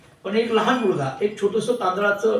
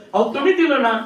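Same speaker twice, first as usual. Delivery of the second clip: louder and higher, insisting; exasperated wife; 6 semitones higher, 6 dB louder than usual. Acted. Wife insisting to her husband the pandit, raised voice. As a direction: press him hard with the words.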